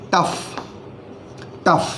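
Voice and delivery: teacher models the word टफ with a short u and final f; pronounced tough. Shown as a demonstration. Teacher saying the word to students, clear model pronunciation. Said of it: "जिसे आप पढ़ेंगे".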